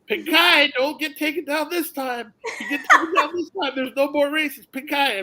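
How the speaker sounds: the friend taunts the angry jockey before the last race; mocking taunt. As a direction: needle him relentlessly. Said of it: "will not let up".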